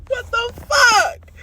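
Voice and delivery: loud, high, slow what the fuck; outraged shout. Real, not acted. Ranting to viewers about the twist, loud high outrage. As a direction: yell it in disbelief.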